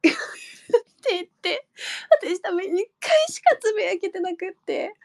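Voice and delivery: laughing through tears; half-laughing, half-crying apology; teary laugh. Real, not acted. Admitting to fans she barely tweeted thanks, tearful.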